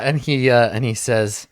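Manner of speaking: normal talking voice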